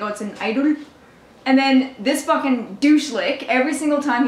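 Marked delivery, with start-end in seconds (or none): 0.0-0.8 mock Indian-accented phone seller; imitated Indian accent. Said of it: "but in his accent he was like".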